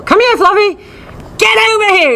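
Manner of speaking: high mock yell across the park; yelling for the dog. Owner yelling for untrained dog, high mock yell. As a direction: call Fluffy, high and impatient. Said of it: "you yell for Fluffy".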